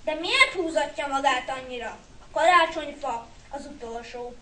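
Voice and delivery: plain reading voice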